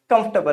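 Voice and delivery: कंफर्टेबल said KUMF-tuh-bl; correct pronunciation. Shown as a demonstration. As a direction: keep the middle of कंफर्टेबल short, no "fort". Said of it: "क्या बोलेंगे?". (कंफर्टेबल को "कम्फ़्टबल" बोलें)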